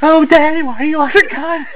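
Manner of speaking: very high-pitched, pleading mock voice; whiny mock character. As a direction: put on a squeaky voice and plead to Daddy. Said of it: "That's why he's like".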